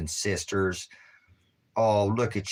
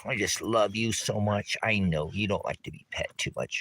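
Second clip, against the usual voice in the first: normal talking voice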